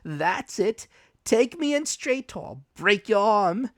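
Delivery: high, loud vicious threat; a cruel high snarl of a threat; menacing threat. Acted. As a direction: threaten to break his arm. Blind man threatens Jim.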